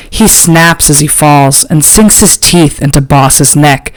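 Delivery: ordinary delivery, nothing marked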